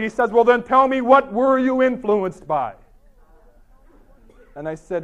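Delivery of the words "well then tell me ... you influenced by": loud, exasperated demand, slowing at the end; very frustrated. Acted. Frustrated professor demands the poet's influence, loud exasperation. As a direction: act out a professor losing patience, loud and drawn-out. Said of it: "very frustrated".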